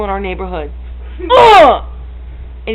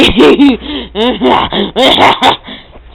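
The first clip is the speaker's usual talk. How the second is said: hyper laugh. Real, not acted. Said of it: "I'm really hyper".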